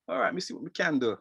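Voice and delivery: a hint of an obliging gran; grandmother's reply. Grandmother answering her grandchild's request, lightly imitated. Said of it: "she's like".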